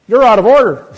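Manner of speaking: mocking judge's outburst; judge's mock shout. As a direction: bellow it like a judge slamming down. Judge perks up and says.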